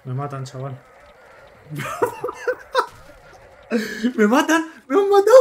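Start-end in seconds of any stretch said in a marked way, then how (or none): 0.8-4.1 sudden high-pitched burst of laughter. Real, not acted. Player dying in game, laughing. 4.2-5.3 loud, high, laughing panic; panicking gamer. Real, not acted. Player being killed in game.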